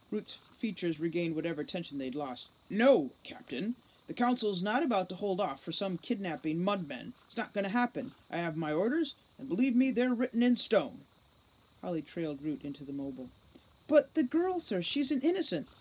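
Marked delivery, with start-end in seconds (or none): 2.7-11.0 stern refusal. A narrator's reading. Commander firmly refusing Holly's plan, louder and stern. 13.9-15.7 much higher, louder plea; pleading. A narrator's reading. Holly pleading with the commander to spare the girl.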